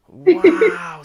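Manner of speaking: high, loud delighted Wow; a big thrilled gasp of wonder; amazed wow. Real, not acted. Host amazed at guest's travels.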